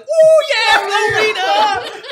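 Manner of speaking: very high, very loud whoop; cheering dwarves. Acted. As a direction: cheer the name, stretched out long. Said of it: "the other two dwarves at the table start like".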